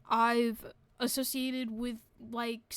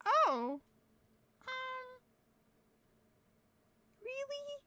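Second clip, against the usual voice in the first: surprised friend; 13 semitones higher, much slower than usual. Retold or imitated. Voices friends' doubtful reply.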